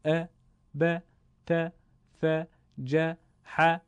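«ا، ب، ت، ث، ج، ح» short and light, fronted "a"; thin fatha. Shown as a demonstration. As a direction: separate light Arabic syllables «ا» to «ح». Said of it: "نازک و کم حجم تلفظ کنیم".

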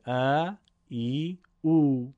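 ا ای او each held slowly; drawn-out long vowels. Shown as a demonstration. Teacher listing the long vowels, drawing each out. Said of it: "صداهای کشیده".

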